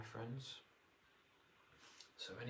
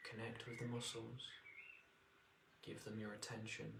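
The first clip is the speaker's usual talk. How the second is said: guided meditation; 5 dB quieter than usual. Acted. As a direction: ease them into relaxation.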